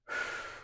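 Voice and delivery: slow audible exhale; long soft calming sigh; calming breath out. Shown as a demonstration. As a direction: show the kids how to breathe out. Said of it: "Breathe in through your nose".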